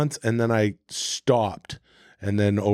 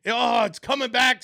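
excited shout; 11 semitones higher than usual. Real, not acted. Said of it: "I remember all that now".

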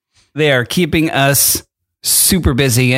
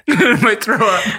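high, slow laughing; laughing too hard to talk; crying with laughter; 6 semitones higher, slower than usual. Real, not acted. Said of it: "Are you crying because you're so happy".

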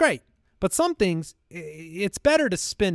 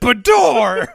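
imitating door sound effect; 6 semitones higher, 7 dB louder than usual. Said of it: "he said".

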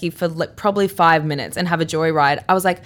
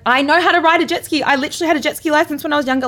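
higher, fast defensive insistence; insistent protest; 8 semitones higher, faster than usual. Retold or imitated. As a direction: prove you know what you're doing. Insisting she can ride.

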